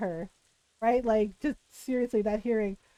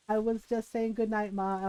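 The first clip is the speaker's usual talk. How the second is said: low, softer timid explanation; nervous quiet excuse; 5 semitones lower, 6 dB quieter than usual. Retold or imitated. Daughter placating her suspicious mom, low and timid. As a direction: mumble it apologetically.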